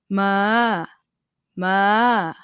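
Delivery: long male-pitched "ma" in high tone. Shown as a demonstration. Teacher shows high tone at male's pitch, held and lifted slightly. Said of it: "For male's pitch would be".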